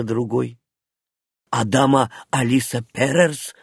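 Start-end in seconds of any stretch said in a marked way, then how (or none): none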